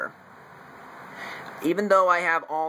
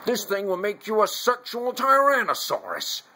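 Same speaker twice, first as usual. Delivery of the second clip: Jesse Ventura; 7 semitones higher than usual. Acted. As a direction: deliver a hammed-up action-film tagline. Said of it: "when Jesse Ventura says".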